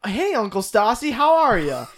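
hearty greeting. Acted. Faking recognition of an uncle, hearty greeting. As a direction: greet them like a long-lost relative. Acting out greeting a supposed relative.

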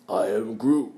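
bad Groot impression. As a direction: attempt Groot and miss, sounding like Stallone. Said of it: "sounds like Sylvester Stallone doing a bad impression of Groot".

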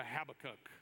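"Habakkuk" with stress on the first syllable, HAB-a-kuk; option A pronunciation. Shown as a demonstration. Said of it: "if you would say A".

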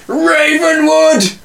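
high, slow, theatrical boast; grand proclamation. Acted. Co-host hailing the villain name Ravenwood, grandly. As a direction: announce the name like a movie title.

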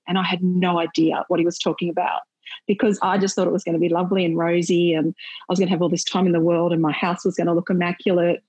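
ordinary talk, nothing marked

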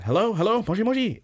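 high-pitched, eager phone answering; acted phone greeting. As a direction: call high into the receiver, imitating a character. Acts out grabbing a ringing phone.

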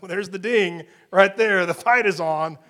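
loud, announcing the fight; loud mock fight call; loud boxing announcer call. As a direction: ring the bell like a ring announcer. Boxing bell image, loud.

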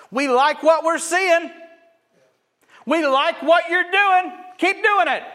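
mock crowd voice. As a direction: caricature a thrill-seeking crowd.

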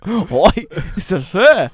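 cartoon Australian caller's disbelief; Australian accent. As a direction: exclaim it as a hammed-up Aussie. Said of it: "He does Australian".

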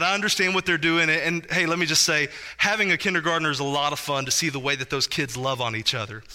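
normal talking voice

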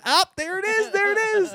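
mock a triumphant gotcha call; excited high call. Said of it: "literally I went".

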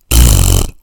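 loud scornful raspberry pfft; dismissive pfft. A narrator's reading. Flugel bird scoffing as the birds leave, loud pfft. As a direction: blow it out with contempt. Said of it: "said the flugel bird".

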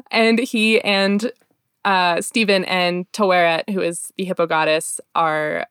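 normal talking voice

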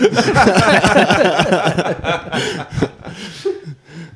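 high burst of laughter; helpless laughter at the story; long real laugh. Real, not acted. Laughing at mum's joke.

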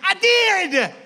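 put-on high, far too loud kid voice; mock little boy insisting at full volume; loud child protesting. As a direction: imitate a kid loudly defending himself. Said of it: "I whispered".

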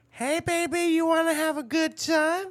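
high sultry come-on voice; high teasing hooker voice. Retold or imitated. Hooker propositioning him, retold.

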